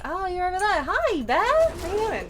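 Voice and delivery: high, sing-song hello to a bear; gushing over a bear she just found; cheery high greeting. Real, not acted. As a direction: greet the bear like a pet, brightly.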